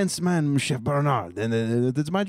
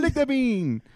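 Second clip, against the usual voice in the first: silly high voice; 8 semitones higher, slower than usual. Acted. Silly chef game, high voice.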